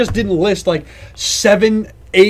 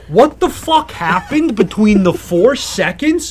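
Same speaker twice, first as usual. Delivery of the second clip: exasperated rant; 6 semitones higher, 5 dB louder than usual. Acted. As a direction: demand an answer, incredulous and worked up. Acted rant at silent parent.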